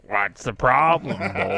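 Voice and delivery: slow, lightly mocking strongman taunt; imitating a tough workmate. Strongman showing the boys up, mock casual taunt. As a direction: tease the crew with put-on nonchalance. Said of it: "and be like".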